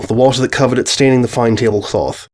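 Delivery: plain reading voice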